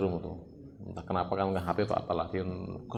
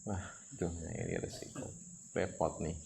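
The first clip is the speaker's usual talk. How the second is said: much softer, dropping into a rueful mutter; rueful murmur; 2 semitones lower, 9 dB quieter than usual. Real, not acted. Preacher muttering about the risk, soft and rueful. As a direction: sigh it out under your breath.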